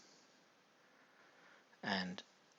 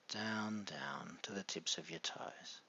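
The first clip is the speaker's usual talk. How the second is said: soft whispered guidance down; whispering; 3 semitones lower, 6 dB quieter than usual. Acted. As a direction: whisper it all the way down. Whispered deepening suggestion.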